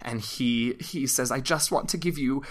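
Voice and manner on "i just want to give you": higher, fast, mildly tearful rush of words; a lightly acted breakdown spilling out fast; losing composure. Guide Arix gives the party a parting gift, lightly acted breakdown. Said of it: "completely loses all composure".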